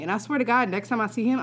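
ordinary delivery

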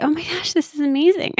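high, excited little burst of wonder; delighted. Retold or imitated. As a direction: gasp it out in happy surprise. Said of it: "I was like".

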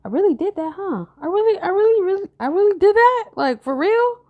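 high, incredulous self-quote; stunned disbelief. Retold or imitated. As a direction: squeak out your own disbelief. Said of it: "I'm like".

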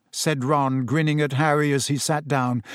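plain reading voice

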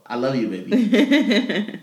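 high, slow, laughing love; romantic. Real, not acted. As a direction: laugh it out lovingly. Husband to wife, laughing.

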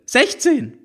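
put-on high teenage voice, matter-of-fact; a high teen girl stating the obvious. A narrator's reading.

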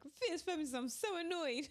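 high, loud, fed-up mock greeting; playfully irritated podcast intro; annoyed. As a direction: sing out the show greeting in pure exasperation. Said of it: "I just really wanted to go like".

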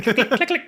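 rapid imitated typewriter keys; typewriter clicking. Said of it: "sounding like a fucking typewriter".

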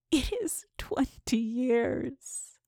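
slow, breathless whispered moan; moaning ghost. Acted. Catherine's ghost answering the dreamer, slow whispered moan. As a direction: let the ghost moan it, drawn out. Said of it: "moaned the voice".

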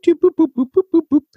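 mock dial tones. Shown as a demonstration. Host showing listeners how to dial in, high beeping. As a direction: mimic dialing the number in high beeps. Dialing the show's phone number.

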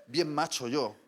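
ordinary talk, nothing marked

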